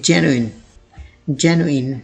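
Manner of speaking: genuine broken into three syllables. Shown as a demonstration. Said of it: "तीन syllables में आप इसको तोड़ के पढ़ेंगे".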